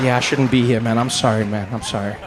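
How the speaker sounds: low, meek acted apology; mock-humbled and apologetic; sheepish apology. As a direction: back down contritely, pitched low. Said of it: "I said".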